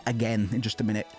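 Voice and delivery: ordinary delivery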